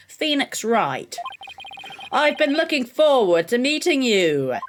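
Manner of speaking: drawn-out, theatrical rival greeting; hammed-up courtroom rival; haughty prosecutor. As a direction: slowly savour the introduction.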